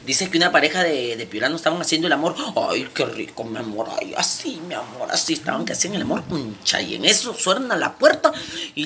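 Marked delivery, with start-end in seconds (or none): 2.5-5.3 low, soft moaning; mock lovemaking moans. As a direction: murmur pleasure in a mock-amorous voice. Imita pareja haciendo el amor. (gemidos fingidos, bajos y suaves)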